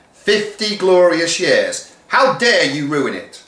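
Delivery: high, slow indignant outrage; a teacher's shocked fury; outraged deputy head. Acted. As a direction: scold them for ruining her day. Deputy head's outraged speech.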